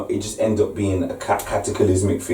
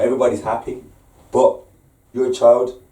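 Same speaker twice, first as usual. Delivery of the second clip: ordinary talk, nothing marked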